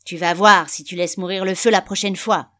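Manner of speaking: loud, forceful scolding; angry threat. A narrator's reading. Father caning his son over the fire, loud and angry. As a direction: shout it while laying on blows.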